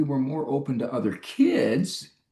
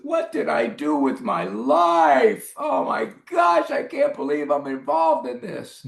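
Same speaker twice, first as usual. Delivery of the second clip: anguished regret; 10 semitones higher than usual. Retold or imitated. Parent imagining his own ruined life, high anguished outcry. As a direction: wail it like a parent who lost it all.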